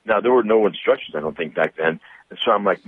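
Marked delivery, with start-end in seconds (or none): none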